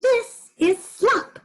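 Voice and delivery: very high, slow, proud squeal; high squeaky pig voice for the class; proud Piggy. Shown as a demonstration. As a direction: show it again, high and pleased. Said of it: "Why don't you try it?".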